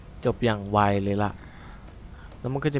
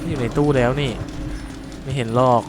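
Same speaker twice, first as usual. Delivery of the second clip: anxious playful taunting; nervous taunt; 3 semitones higher than usual. Real, not acted. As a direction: tell the monster it imagined it. Taunting the monster from cupboard.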